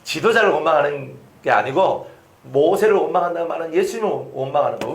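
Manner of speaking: ordinary talk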